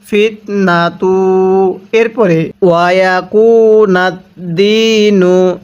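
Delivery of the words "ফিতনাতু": ফিতনাতু said slowly, syllable by syllable; careful slow ফিতনাতু; slow recitation. Shown as a demonstration. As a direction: draw out ফিতনাতু for the class. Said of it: "তাহলে".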